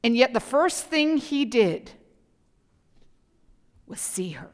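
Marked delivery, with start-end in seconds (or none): 3.9-4.5 whispered. Real, not acted. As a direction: drop to a whisper. Preacher hushes key phrase.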